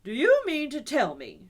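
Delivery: loud, emphatic demand; shout. Acted. Rat shouting and thumping the table at Toad. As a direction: bang it out. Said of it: "shouted the rat".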